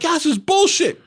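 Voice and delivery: high-pitched mock outrage; losing his mind. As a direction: imitate a father crying foul. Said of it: "he lost his mind".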